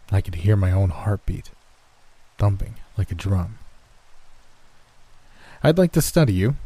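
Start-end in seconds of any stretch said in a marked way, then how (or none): 5.6-6.6 matter-of-fact. A narrator's reading. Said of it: "he said matter-of-factly".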